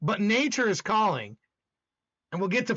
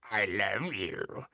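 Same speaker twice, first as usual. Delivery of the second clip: low, slow, strange put-on voice; weird voice; 7 dB quieter, much slower than usual. Shown as a demonstration. Streamer showing a sarcastic love you, weird low voice. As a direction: drag it out low in an odd voice. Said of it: "like, in some weird voice".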